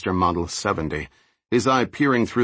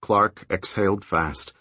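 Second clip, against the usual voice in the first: usual speaking voice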